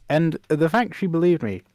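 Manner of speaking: ordinary delivery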